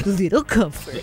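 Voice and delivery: lightly imitated solemn reporter; mock dead-serious news read; earnest reporter voice. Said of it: "You do your super earnest, like, reporter voice".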